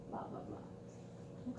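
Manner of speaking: unvoiced throwaway blah blah; teacher tosses off a soft filler; breathy filler aside. Real, not acted. Teacher mutters a filler to the class.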